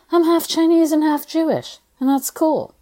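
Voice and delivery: high, airy, breezy therapist voice; light and breezy. Retold or imitated. Therapist breezily reassuring her client, high and light. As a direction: toss it off as if it's nothing. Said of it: "in a super light and breezy, totally nonchalant way".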